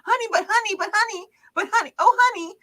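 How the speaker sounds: high, wheedling pleas of honey; pestering husband. Retold or imitated. Husband hovering over his wife, high wheedling nagging. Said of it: "up in your face going".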